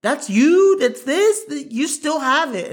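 loud, high-pitched acted astonishment; amazed disbelief. Voicing fans at an actor's comeback, loud amazed disbelief. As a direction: burst out in mock amazement.

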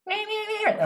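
high squeaky mock seagull meows; seagull cries. Friend mimicking the seagulls by the bay, high mock meows. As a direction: squeal like gulls outside the window.

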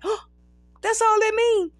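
high-pitched mock disbelief; surprised. Performer hearing the word's meaning from best friend, surprised.

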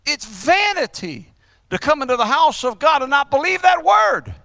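rising, high and forceful exhortation; driving, rhythmic sermon cadence; full-force preaching. Real, not acted. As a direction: punch out each command from the pulpit.

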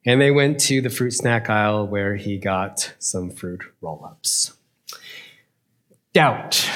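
ordinary talk, nothing marked

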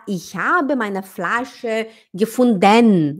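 slow sentence with "gefunden" ending in a full "denn"; full -den ending. Shown as a demonstration. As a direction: overpronounce the last syllable of "gefunden". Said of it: "a gente não fala".

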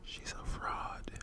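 slow, very soft whisper; whispered aside. Real, not acted. Co-host commenting on her phone voice, whispering. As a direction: whisper it slowly behind the caller's back.

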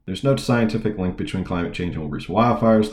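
ordinary talk

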